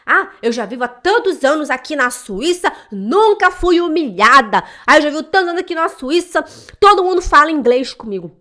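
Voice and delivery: loud, sing-song mockery of a bragging expat; sarcastic imitation of a smug immigrant; mocking boastful expat. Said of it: "Tem gente que fala assim".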